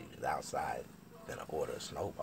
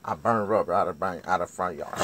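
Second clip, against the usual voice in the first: ordinary talk, nothing marked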